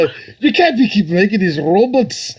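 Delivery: high-pitched, hammed-up Scottish accent; Scottish Commander Red. Imitating a cartoon commander griping about robots, exaggerated Scottish accent. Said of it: "he was Scottish for some reason, so I'm gonna sound like Pierce Brosnan".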